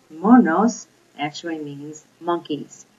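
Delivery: normal talking voice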